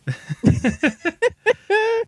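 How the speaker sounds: high laughing burst; burst of laughter. Real, not acted. Laughing at listener comment.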